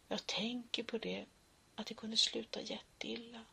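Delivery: soft unvoiced whisper; whispered fear; whispered thought. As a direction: murmur the dread to yourself.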